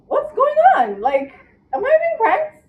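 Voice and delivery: squeaky high disbelief; mock outrage. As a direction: act wildly offended, high.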